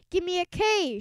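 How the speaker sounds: high, loud cheer call; cheerleader shout. A narrator's reading. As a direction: call it out to the stands. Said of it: "shouted the cheerleaders".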